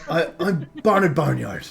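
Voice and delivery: halfling publican in broad West Country; eager West Country self-introduction; West Country accent. Acted. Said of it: "just gonna have to stick with it".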